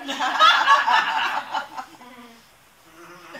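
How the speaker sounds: loud burst of laughter. Real, not acted. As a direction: laugh at your own label. Laughs at own phrase.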